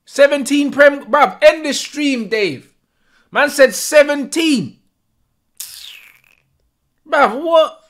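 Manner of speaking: can't believe the number, shouting it out; shocked disbelief. Real, not acted. Shocked at Haaland's goal tally.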